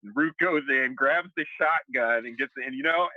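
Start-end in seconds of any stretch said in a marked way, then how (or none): none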